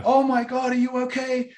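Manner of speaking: high, loud, shrieking mock panic; hammed-up panicky mom over a small fall; mock hysterical mother. Father imitating a mother panicking over the child, high shrill mock alarm. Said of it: "they're like".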